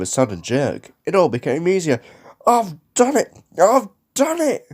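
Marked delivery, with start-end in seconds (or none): 2.4-4.7 high, loud exultant cry; triumphant puffing. A narrator's reading. James reaching the top of the hill, triumphant. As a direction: crow it in triumph. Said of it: "he puffed triumphantly".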